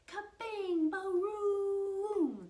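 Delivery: loud, high booming thunder cry; big mock thunder crash; thunder sounds. A narrator's reading. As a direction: shout it like a thunderclap.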